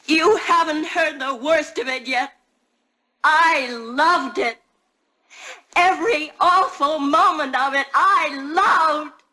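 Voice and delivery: defiant shout. Acted.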